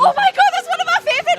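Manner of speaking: high, delighted gush; excited. Real, not acted. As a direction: squeal with delight at the shared favourite. Amazed at shared favourite song.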